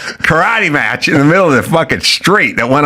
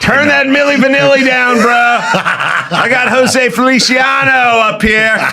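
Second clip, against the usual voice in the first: much higher, slow hammed-up neighbor voice with a laugh; mock neighbor yelling; 13 semitones higher, slower than usual. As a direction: yell it like the annoyed new neighbor. Playing the neighbor yelling back.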